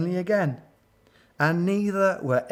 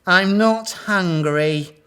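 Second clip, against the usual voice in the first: slow, drawn-out refusal, pitched up; louder, higher, slow sighing brush-off; sighed refusal; 5 semitones higher, 6 dB louder, slower than usual. A narrator's reading. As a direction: draw it out like a big theatrical sigh. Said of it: "sighed Delilah".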